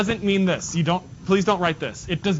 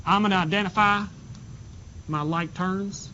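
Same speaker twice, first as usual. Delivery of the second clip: a light, hammed-up Southern accent, slowed down; 3 semitones higher than usual. Teacher joking with the class in a fake Southern accent. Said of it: "that was a horrible Southern accent".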